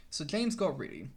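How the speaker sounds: ordinary delivery, nothing marked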